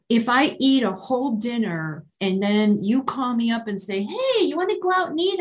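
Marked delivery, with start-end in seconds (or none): 4.0-5.4 imitating a friend's invite.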